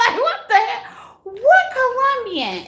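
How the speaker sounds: shrieking what the hell, way up high; incredulous high squeal; high-pitched shriek. Real, not acted. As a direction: squeal it in disbelief. Baffled by viewer's question.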